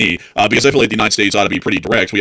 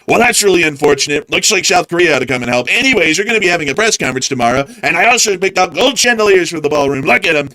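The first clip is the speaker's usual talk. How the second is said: higher, breezy dismissive leader voice; mock official response; 6 semitones higher than usual. Mocking the US response to the attack, higher breezy pompous voice. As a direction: brush it off like a vain leader.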